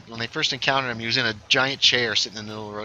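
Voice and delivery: ordinary talk, nothing marked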